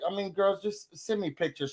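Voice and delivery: tired, fed-up quote. Retold or imitated. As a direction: sound like a woman sick of being asked. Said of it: "That lady was like".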